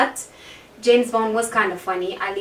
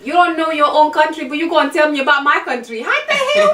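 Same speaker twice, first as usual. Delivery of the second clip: loud, higher exasperated rant; 7 semitones higher, 7 dB louder than usual. Real, not acted. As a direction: let the frustration out loud. Heated rant at outsiders.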